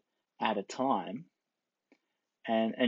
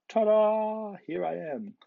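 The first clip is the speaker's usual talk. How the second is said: high, louder mock reveal; showy triumph; 10 semitones higher, 5 dB louder, slower than usual. Faker walking out on side A, showy mock triumph. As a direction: announce yourself like a stage trick. Faker showing off a lucky success.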